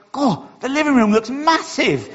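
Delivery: high-pitched retold astonishment; amazed. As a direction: gasp at how big it looks, pitched right up. Retold family amazement at cleared room.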